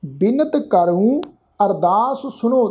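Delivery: slow reading, a clear break after ਕਰੂੰ; pause after ਕਰੂੰ. Shown as a demonstration. Teacher showing congregation where to rest, slow with pause after ਕਰੂੰ. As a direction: stretch ਕਰੂੰ and rest before ਅਰਦਾਸ ਸੁਣੋ. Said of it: "ਕਰੂੰ ਸ਼ਬਦ ਤੋਂ ਬਾਅਦ ਵਿਸ਼ਰਾਮ".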